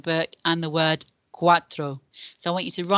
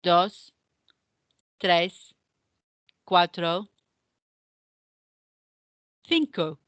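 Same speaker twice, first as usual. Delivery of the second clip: each number said clearly with long gaps, for repeating; slow counting drill; much slower than usual. Shown as a demonstration. Teacher modelling Spanish numbers for pupils to repeat, slow and clear.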